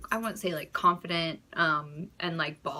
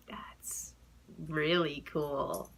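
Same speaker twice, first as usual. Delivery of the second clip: sarcastic; 4 semitones lower, 5 dB quieter, much slower than usual. Retold or imitated. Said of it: "And I'm just like".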